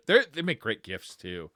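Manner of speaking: ordinary talk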